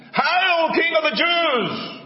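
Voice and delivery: high, drawn-out jeering cry; mocking call-out. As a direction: hail him like a mocking soldier. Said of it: "they began to call out to him".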